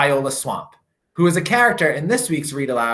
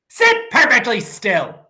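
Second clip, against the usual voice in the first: strict witchy teacher; 8 semitones higher, 3 dB louder than usual. A narrator's reading. Miss Swamp orders class.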